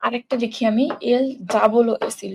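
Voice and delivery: ordinary delivery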